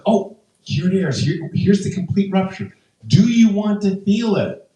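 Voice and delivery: high, chirpy helpful nurse; imitating an over-friendly medic; nice nurse. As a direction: offer it eagerly, pitched well up. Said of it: "nurse practitioner was so nice".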